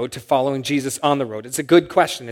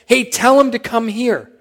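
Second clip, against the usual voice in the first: high-pitched call across a crowd; retold shout; 8 semitones higher, 4 dB louder than usual. Jesus shouting to people near him to fetch the beggar, retold shout. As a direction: call out to be relayed through the crowd. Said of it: "And Jesus shouts".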